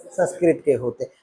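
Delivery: teacher answering for the class; quiet echo answer. Shown as a demonstration. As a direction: drop the voice and answer Sanskrit के होते हैं softly. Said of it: "किसके होते हैं?".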